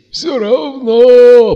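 very high, loud drawn-out croak; wheezing. A narrator's reading. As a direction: strain it out as a dying rasp. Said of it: "прохрипел Макс". (протяжный предсмертный хрип)

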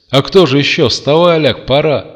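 громко и высоко тормошить; подгоняй лежачего друга; бодрая побудка. A narrator's reading. Приятель торопит лежачего. (громкая нетерпеливая побудка)

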